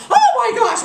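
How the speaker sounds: high, loud astonished cry; shocked gasp. Acted. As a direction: blurt it out in shock. Queen sees Isis appear.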